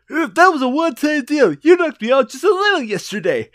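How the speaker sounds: high-pitched character comeback; acted retort. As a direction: protest in a high put-on voice. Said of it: "And I said".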